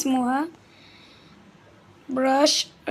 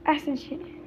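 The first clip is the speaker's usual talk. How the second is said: usual speaking voice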